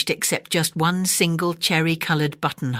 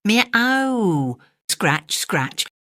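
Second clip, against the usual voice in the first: cat meowing; 5 semitones higher, much slower than usual. A narrator's reading. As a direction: whine like a cat at the window. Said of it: "scuffled Simpkin".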